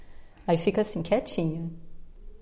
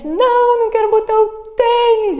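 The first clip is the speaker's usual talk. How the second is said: imitating a toddler's high, loud wail; escândalo de birra; 13 semitones higher, 8 dB louder than usual. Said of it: "E dá um, um escândalo". (grito agudo de criança birrenta)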